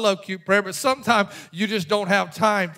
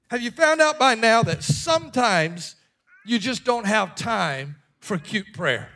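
ordinary talk

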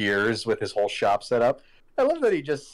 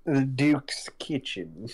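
announcer voice; 3 semitones higher, much slower than usual. Pretend. Reads the shop name in voice.

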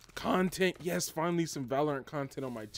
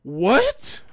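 loud, high rising yelp; shocked exclamation; 5 semitones higher, 10 dB louder than usual. Real, not acted. Gamer startled mid-match, shocked loud question. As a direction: burst out in real disbelief.

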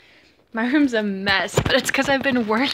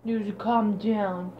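usual speaking voice

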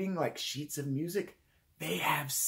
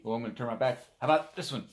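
normal talking voice

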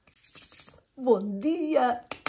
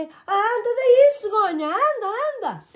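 calling out; 10 semitones higher, 4 dB louder than usual. A narrator's reading. Calling the stork over.

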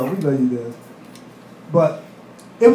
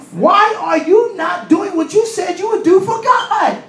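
high, slow, shouted accusing voice; Satan voice, shouting up high; Satan's taunting voice; 10 semitones higher, slower than usual. Acted. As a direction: play Satan calling you out. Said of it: "Satan is looking at you like".

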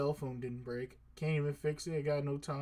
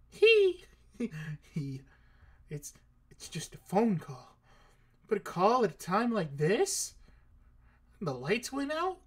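startled; 6 semitones higher, 6 dB louder, slower than usual. Real, not acted. Startled by a blackout call.

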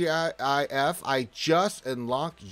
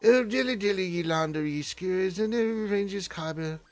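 hammed-up English accent, higher pitched; 6 semitones higher than usual. Shown as a demonstration. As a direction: put on a lofty mock-English voice. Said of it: "I gotta do the English voice".

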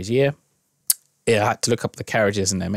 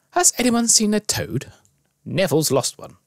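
high-pitched bossy schoolgirl; a know-it-all girl taking charge; rather bossy; 12 semitones higher than usual. A narrator's reading. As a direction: demand it in a raised, officious pitch. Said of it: "She sounded rather bossy".

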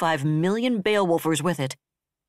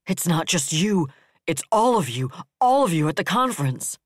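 raw anguish; 2 semitones higher, 4 dB louder than usual. Acted. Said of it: "voice was raw with anguish".

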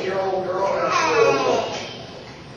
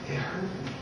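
very soft, hurt child imitation; tearful little girl; 15 dB quieter than usual. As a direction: play a small, wounded voice through crocodile tears. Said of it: "She's got these little alligator tears".

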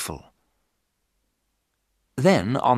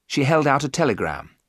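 ordinary delivery

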